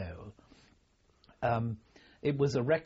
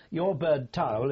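lightly imitated general calling out, louder; a touch of an officer's shout, higher; retold shout; 3 semitones higher, 6 dB louder than usual. General calling a junior officer to shoot, mild mock shout. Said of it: "between the general shouting".

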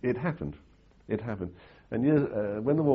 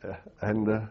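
ordinary talk

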